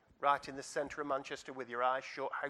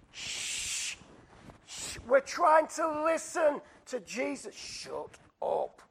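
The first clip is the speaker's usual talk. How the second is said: loud, slow, high telling-off after a hiss; irritated crowd member hushing someone; shushing rebuke; 13 semitones higher, 6 dB louder, much slower than usual. Acted. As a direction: shush the noisy man crossly. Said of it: "told him to be quiet".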